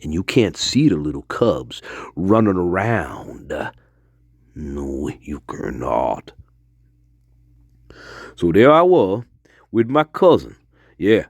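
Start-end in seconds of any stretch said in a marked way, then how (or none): 4.5-6.3 low, slow, deliberate denial; grave emphasis. Real, not acted. Storyteller warning listener about hidden bear cubs, grave. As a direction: draw it out low and softly, final.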